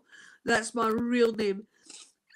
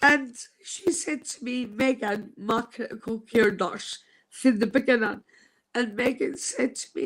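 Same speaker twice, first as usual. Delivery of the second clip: ordinary talk, nothing marked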